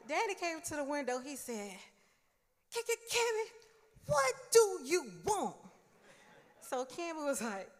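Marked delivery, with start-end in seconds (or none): 2.7-5.6 high, loud, stammering in irritation; irritated stutter. Retold or imitated. Dad at the window, stuttering at his nagging daughter. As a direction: stammer out his name, fed up. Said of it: "whenever Daddy was really irritated, he started stuttering".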